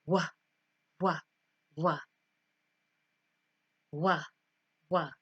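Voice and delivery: short isolated "wa" puffs, each with a rush of air; airy W sound. Shown as a demonstration. Teacher showing air on the fingers, short puffed wa. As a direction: blow out each "wa" briefly, with pauses between. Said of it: "You should feel air".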